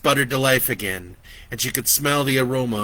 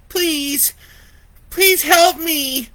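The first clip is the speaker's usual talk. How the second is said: weak plea; 7 dB louder, much slower than usual. Acted. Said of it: "Lori's voice was weak".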